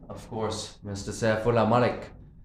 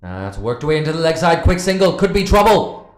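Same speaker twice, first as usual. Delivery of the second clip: rising, louder, faster call; excited commentary; 5 semitones higher, 8 dB louder than usual. Real, not acted. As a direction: build the call as the run unfolds. Live commentary, tense run.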